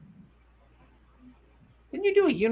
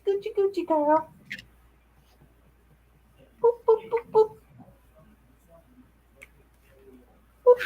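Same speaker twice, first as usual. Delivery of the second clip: much higher playful cooing and booping; 11 semitones higher than usual. Real, not acted. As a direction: nonsense coos at the puppy. Baby talk nonsense to dog.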